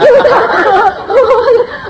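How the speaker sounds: very high, loud burst of laughter. Real, not acted. Mother laughs at how scared her son was, loud and high. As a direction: crack up at the memory.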